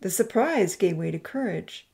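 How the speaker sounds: ordinary delivery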